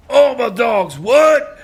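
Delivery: loud, high hype-rapper shout. Retold or imitated. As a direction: bark it out like a rap ad-lib. Imitates [name] after naming him.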